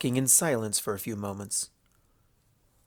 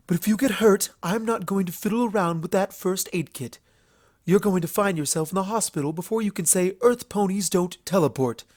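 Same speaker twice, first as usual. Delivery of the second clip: higher, boastful royal voice; haughty princess; 7 semitones higher than usual. Acted. Luna boasting to Applejack she can handle anything, haughty. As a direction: brag about her powers.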